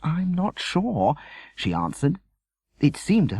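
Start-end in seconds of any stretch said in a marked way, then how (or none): 0.0-1.1 slow, hesitant reply; unsure, feel your way. Acted. Mother unsure what father should wear, slow and hesitant. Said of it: "Mother hesitated".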